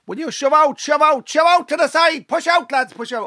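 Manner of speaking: high-pitched Clare coach hollering orders; coach's shout. Retold or imitated. Coach to under-ten lads from the sideline, shouting. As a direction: imitate a frantic sideline yell in Clare accent. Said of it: "He's shouting to his young lad".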